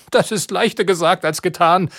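a put-upon witch sighing at the task. A narrator's reading. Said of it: "hat die Hexe gesagt".

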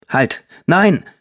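mother grabbing her son's wrist, shouting; sharp cry. A narrator's reading. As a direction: stop your son at once. Mother stops son forcefully.

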